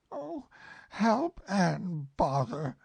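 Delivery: high, slow, drawn-out groan of annoyance; slow bothered bear. Acted. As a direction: stretch it out, fed up. Pooh, slow.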